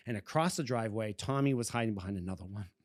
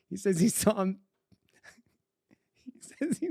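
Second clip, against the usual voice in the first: high voice breaking into laughter; cracking up; 8 semitones higher than usual. Real, not acted. Storyteller cracking up over his friend and the penny, laughing. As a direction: try to get the line out through laughter.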